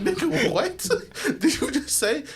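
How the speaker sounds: high, laughing what did you just say; half laugh it in shock; mock shock, laughing through the words; laughing disbelief. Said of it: "I'm like".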